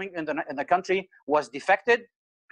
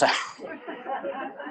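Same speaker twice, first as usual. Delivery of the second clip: a breathless, embarrassed laugh, caught out; quiet stumped laugh that breaks out; 6 semitones higher, 7 dB quieter than usual. Real, not acted. Caught out on a live show: breathless embarrassed laugh. Stumped by Arabic term question.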